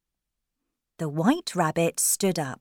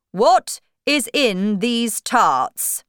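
slow, loud, raised questioning; storybook king; 5 semitones higher, 7 dB louder, slower than usual. Acted. King questioning the cook, slow loud regal voice. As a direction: interrogate slowly and loudly as king. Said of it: "the king asked the cook".

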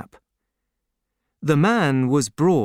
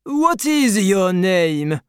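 higher, slow, lordly question; haughty Marquis; 7 semitones higher, 5 dB louder, slower than usual. A narrator's reading. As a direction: demand it from above.

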